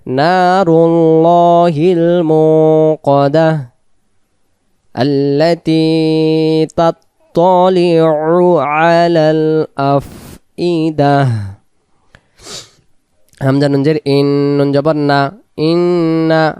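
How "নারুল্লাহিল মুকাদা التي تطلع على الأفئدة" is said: very slow chanted line; Quran recitation. Shown as a demonstration. Teacher reading the whole line for students, slow chant. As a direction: draw out every syllable in a melodic chant. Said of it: "লাইনটি যদি পড়ি".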